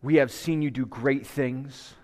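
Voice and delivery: slow, low, hushed pastoral prayer. Acted. Pastor opening the service in prayer, slow and hushed.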